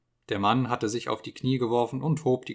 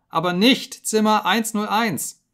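high, loud, slow terrified begging; screamed plea; 10 semitones higher, 7 dB louder, slower than usual. Acted. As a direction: drag out the plea in panic. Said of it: "schrie er".

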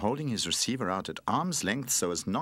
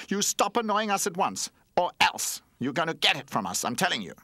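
louder, higher scolding; 10 semitones higher, 5 dB louder than usual. Acted. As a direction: warn them off sternly. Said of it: "Skateboard started scolding".

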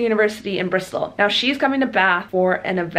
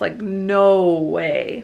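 "Like, no way" stretched very slowly, falling; drawn-out emphasis; much slower than usual. Real, not acted. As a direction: drag out "no way". Insisting walls are soundproof.

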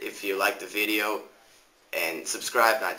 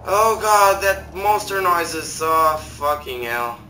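startled fear; 6 semitones higher, 7 dB louder than usual. Real, not acted. Startled by monster noise.

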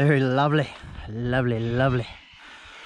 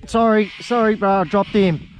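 higher and louder, flustered sorry; blurting it out as the fish slips; rueful apology; 5 semitones higher, 5 dB louder, slower than usual. Real, not acted. Angler apologising to mate after dropping fish, higher, louder.

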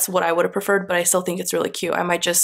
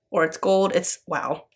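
normal talking voice

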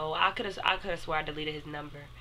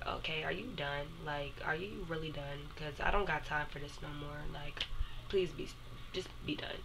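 much softer, weary and dismissive; bored and done; 10 dB quieter than usual. Retold or imitated. Fed-up girlfriend tells ex she's done, softly weary. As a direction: let it sag like you've had enough. Bored reply to ex's excuses.

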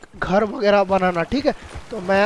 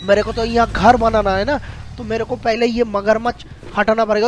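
normal talking voice

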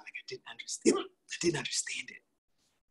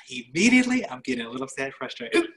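exasperated; 8 semitones higher, 10 dB louder than usual. Real, not acted. Reacting to needing an IV.

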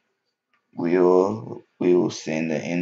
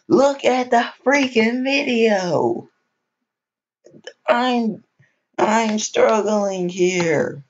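high, slow, pleading squeak; whiny plea; 4 dB louder, slower than usual. Pretend. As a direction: beg viewers in a squeaky whine. Pleading with viewers, exaggerated.

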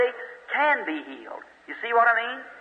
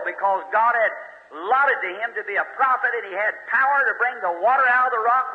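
preaching at full force; 6 semitones higher than usual. Acted. Preacher warning the congregation about unconfessed sin, at full force. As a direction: hammer the point home to the congregation. Preacher retelling Moses story.

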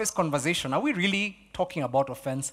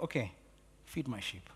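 much softer, lower, gentle command; soft Jesus; 6 semitones lower, 14 dB quieter than usual. Retold or imitated. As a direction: hush it like Jesus answering Peter. Jesus voiced in retold scene.

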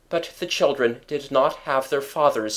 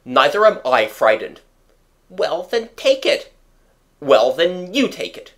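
squabbling back and forth, pitched up; stubborn, raised-voice retorts; defiant squabble; 6 semitones higher, 6 dB louder than usual. A narrator's reading. Father and son daring each other over medicine, defiant.

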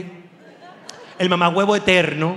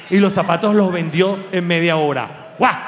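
usual speaking voice

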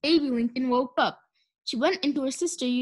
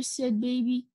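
usual speaking voice

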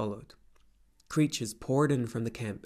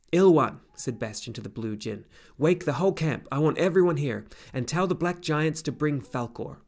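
louder, higher commanding call; calling out orders; 4 semitones higher, 6 dB louder than usual. Acted. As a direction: rouse the whole camp. Bastian calls out orders.

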